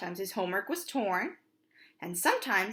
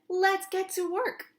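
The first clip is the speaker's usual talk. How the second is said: eager dad; 10 semitones higher, slower than usual. Acted. Pop agrees.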